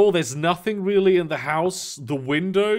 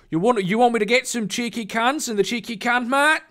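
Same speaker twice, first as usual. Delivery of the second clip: higher Aussie barman voice, rising on mate; playful mock Australian shopkeeper; mock Australian accent; 8 semitones higher than usual. Acting out an Australian order, in a mock Australian accent. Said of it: "I fucking love your Australian accent".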